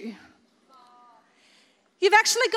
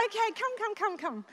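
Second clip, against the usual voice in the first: much softer coaxing call; coaxing a cute animal; 10 dB quieter than usual. Retold or imitated. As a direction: softly coax a little fox closer. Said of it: "I'm like".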